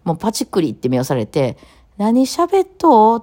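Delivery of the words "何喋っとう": high, slow, baffled asking what she's saying; drawn-out puzzled question, voice pitched way up; ぽかんとした友達. Retold or imitated. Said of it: "って言われて終わるんですよ". (目をパチクリさせた同級生が、高くゆっくり戸惑って聞き返す)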